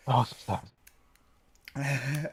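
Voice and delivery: soft, fading mock pity; dramatically pitying; mock sad. Mock sympathy for hiccups.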